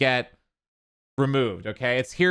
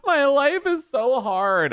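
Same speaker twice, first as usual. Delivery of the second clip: high, whiny mock sob; hammed-up tearful self-pity; mock crying; 11 semitones higher than usual. Imitating a mother freaking out for TikTok followers, high mock crying. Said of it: "just crying and freaking out".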